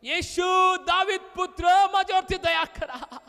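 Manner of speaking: insistent shout from the crowd. Acted. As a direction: call out desperately to Jesus. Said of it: "तिने अधिकच ओरडलं".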